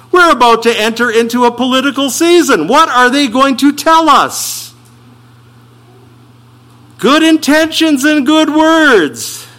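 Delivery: high, loud ironic build to "Good intentions and good words"; mock campaign promise. Preacher mocks politicians' promises to the church, high and ironic. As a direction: sneer at the politicians' line.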